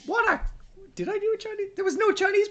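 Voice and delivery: very high-pitched indignant protest; incredulous squeal. Real, not acted. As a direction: squeak in baffled disbelief at the accusation. Mock protest at accent accusation.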